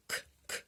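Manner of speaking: К, к breathed out without voice; voiceless к demo. Shown as a demonstration. Teacher to class repeating the first sound, whispered k. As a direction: puff out the bare к, no voice behind it. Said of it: "Так".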